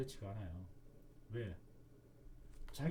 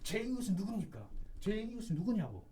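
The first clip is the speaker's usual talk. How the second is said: higher, mildly heated challenge; indignant demand; 5 semitones higher than usual. Acted. Religious leaders confronting Jesus, mildly heated demand. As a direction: throw the question back in protest. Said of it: "막 화를 내요".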